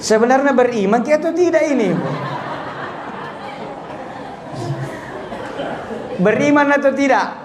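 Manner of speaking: high, laughing through the question; laughing challenge to the audience. Real, not acted. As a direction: chuckle as you ask the crowd.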